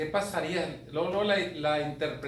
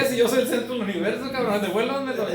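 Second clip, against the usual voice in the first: higher and louder, fast outraged yelling; mock furious rant; 6 semitones higher, 8 dB louder than usual. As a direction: rage like the world owes you your phone.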